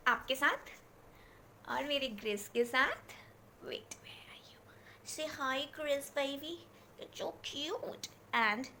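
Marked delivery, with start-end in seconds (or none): 3.7-8.7 hushed, softer coo to the pet; pet talk. Real, not acted. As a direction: whisper and coo at a cuddly pet. Calling and cooing to her pet.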